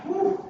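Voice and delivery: a high blown-out whew; hot whew. Retold or imitated. Man sweating in the marsh, a high blown-out whew. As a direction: blow it out, overheated.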